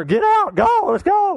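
high, loud, playful get-out call; mock shooing shout. Pastor playfully shooing the congregation off to lunch, high loud mock call. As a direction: pretend to chase them out to lunch.

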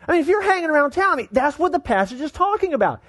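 high-pitched, incredulous preaching; amazed preaching. Real, not acted. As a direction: retell it in astonishment, pitched high, with pauses for effect. Preacher excitedly retells bizarre passage.

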